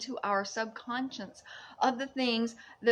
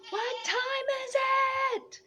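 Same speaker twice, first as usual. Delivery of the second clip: much higher, airy sing-song; mystical high voice; 14 semitones higher than usual. Pretend. Mystic high question posed to the viewers. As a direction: ask it like a spirit.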